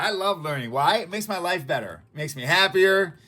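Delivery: normal talking voice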